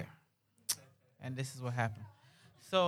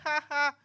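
high mock laugh; put-on high "ha ha" laughter; imitated laughing; 10 semitones higher than usual. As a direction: imitate people laughing at you. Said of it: "they just laughing".

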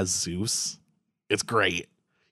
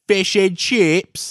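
very slow, higher put-on English accent; mock English accent; 8 semitones higher, 4 dB louder, much slower than usual. Joking about English food in a mock English accent.

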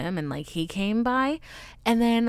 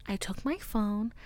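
normal talking voice

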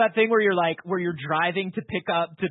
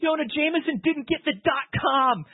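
laughing outburst; 10 semitones higher than usual. Real, not acted. Gloating that Jameson lost the domain, laughing shout. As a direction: crack up while you say it.

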